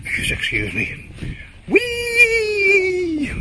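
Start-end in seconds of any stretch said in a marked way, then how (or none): 1.7-3.3 high, loud silly whoop; playful yell. Pretend. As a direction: whoop it like a kid on a slide. Joking about needing a wee.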